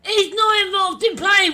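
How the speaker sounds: angry. Real, not acted. Ranting at football referee decision.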